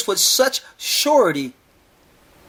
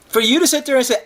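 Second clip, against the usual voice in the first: ordinary talk, nothing marked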